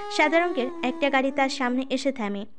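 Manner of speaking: ordinary delivery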